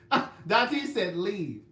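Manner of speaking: loud laugh through the words. Real, not acted. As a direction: read it out still laughing. Laughing while reading comment.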